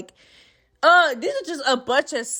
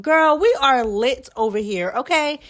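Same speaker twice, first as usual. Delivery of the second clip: excited sign-off; 3 semitones higher, 4 dB louder, slower than usual. Real, not acted. Hyped sign-off.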